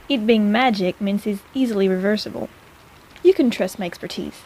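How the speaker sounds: normal talking voice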